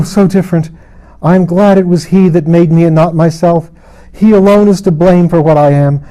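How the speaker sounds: high, loud, defiant anguish; tormented proud queen. Acted. Lilith defies her maker before Mara, loud and anguished. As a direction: hurl every word at heaven, raging and desperate.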